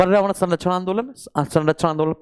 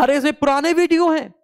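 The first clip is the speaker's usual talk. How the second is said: higher-pitched imitation of grumbling; mock complaint; 7 semitones higher than usual. Teacher imitating students who object to old videos, mock complaining.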